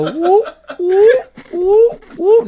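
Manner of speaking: high-pitched whooping through laughter; high laughing whoops. Pretend. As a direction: squeal whoop whoop while laughing. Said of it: "So you go".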